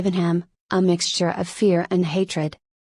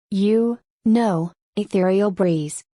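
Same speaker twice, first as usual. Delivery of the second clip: plain reading voice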